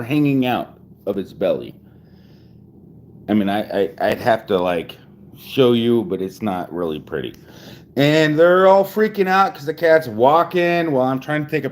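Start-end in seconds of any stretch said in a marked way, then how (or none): none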